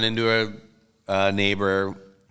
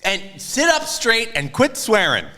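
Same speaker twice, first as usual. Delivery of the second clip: scolding mom voice; 13 semitones higher than usual. Retold or imitated. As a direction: play Mom telling her son off, much higher. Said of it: "she doesn't want me getting up".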